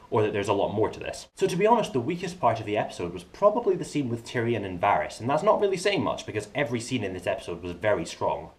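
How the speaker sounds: ordinary talk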